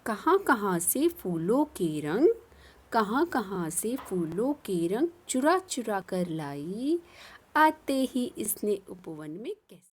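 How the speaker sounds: put-on sing-song poem reading for children; poem recitation. Teacher recites poem to class in a lilting voice.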